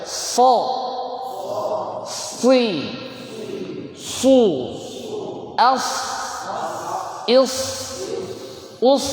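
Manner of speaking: high isolated syllables with a heavy rounded s; makhraj so. Shown as a demonstration. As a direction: drill "So, Si, Su, As, Is, Us" as shad, long hiss. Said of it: "Kita coba yang so". (latihan shad tebal, satu-satu)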